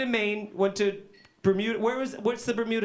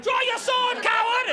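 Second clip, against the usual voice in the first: hammed-up old English duelist, shouted much higher; mock duel challenge; 11 semitones higher than usual. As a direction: bellow the challenge like a pompous swordsman.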